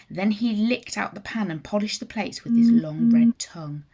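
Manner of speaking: usual speaking voice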